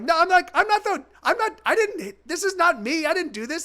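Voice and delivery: retelling how he pleaded his innocence; mock indignant protest. Retold defending himself to strangers.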